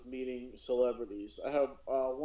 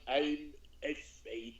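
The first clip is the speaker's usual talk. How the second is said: Emperor voice; slower than usual. Retold or imitated. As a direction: murmur it like a sinister old emperor. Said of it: "I start doing the Emperor's voice for her".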